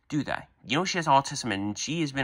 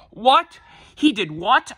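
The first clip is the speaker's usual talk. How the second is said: shocked, much higher and louder outcry; shocked what; 11 semitones higher, 7 dB louder, slower than usual. Acted. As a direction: blurt it out in disbelief, pitched way up. Father hears his son bullied.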